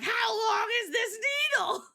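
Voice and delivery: high alarmed shout at the needle; panicked cry. Retold or imitated. Patient staring at a long needle, panicked shout. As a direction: yell it in horror. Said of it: "I'm like".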